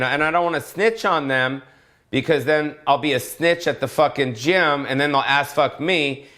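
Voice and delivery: ordinary talk, nothing marked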